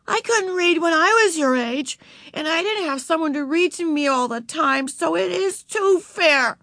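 put-on high, emphatic girl's retort; arguing. A narrator's reading. Beezus arguing with little sister Ramona, high and insistent. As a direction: argue back in a high child's voice. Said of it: "argued Beezus".

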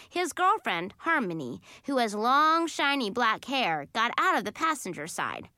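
ordinary delivery, nothing marked

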